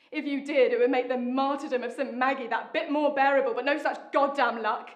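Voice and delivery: loud, exasperated complaint; bitter. Acted. To husband who won't lose his looks, loud and bitter.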